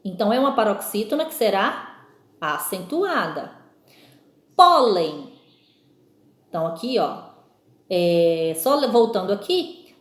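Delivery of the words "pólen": Pólen loud, PÓ strong and falling away; stressed first syllable. Shown as a demonstration. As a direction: hit PÓ hard in Pólen. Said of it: "ouvir qual é a sílaba forte".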